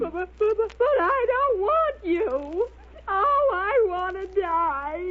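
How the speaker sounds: a wailing, tearful lament; 1 crying. Acted. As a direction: sob out the rejection and despair. Olive heartbroken, rejects Wimpy.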